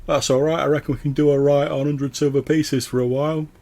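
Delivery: loud, high voice calling from the crowd; someone at the back. Acted. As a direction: pipe up loudly from the back of the group. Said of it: "you hear someone at the back go".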